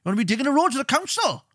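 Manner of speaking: high, fast mock tough-guy jeering; mocking hard man. Mocking the old-school man who says get a job, high jeer. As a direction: sneer like a working-class dad. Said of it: "it's always been like".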